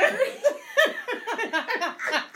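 long high laughter; hard laugh. Real, not acted. Mother cracking up at the popcorn story, real laughter. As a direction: let it roll out.

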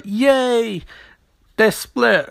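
high-pitched imitated cheer; hammed-up hooray for a group; mock cheering. Team celebrates the two men being split, mock cheering.

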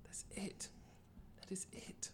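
fading to a whisper. Pretend. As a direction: drop to a whisper.

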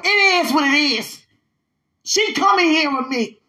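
high, loud, impassioned declaration; emotional outburst. Real, not acted. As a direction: burst out with real feeling. Suddenly overcome, declares she is coming.

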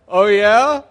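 loud, high mock "Oh, yeah?"; sarcastic teen. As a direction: scoff at her like a defiant fourteen-year-old. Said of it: "I'm like".

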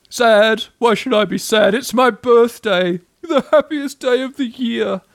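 high, loud mock-cheerful protest; indignant Eeyore. A narrator's reading. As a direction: protest with ironic birthday cheer.

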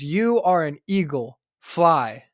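firm slow call to the eagle; urging command. Acted. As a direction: push the bird off the roof. Naturalist urges eagle again.

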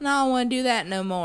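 mock old man. Joins the old man bit.

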